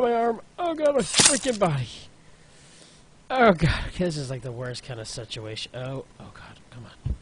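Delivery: ordinary talk, nothing marked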